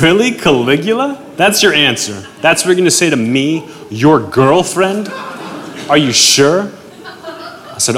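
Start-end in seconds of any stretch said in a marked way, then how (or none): 0.0-6.7 higher, rising questions in disbelief; incredulous girlfriend. Acted. Girlfriend questioning his quiz answer, incredulous. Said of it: "And she goes".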